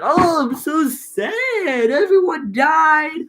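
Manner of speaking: high, slow mock-whiny moan; whiny hero. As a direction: ham up the pitiful sad hero. Mock whiny summary of Odysseus' lament.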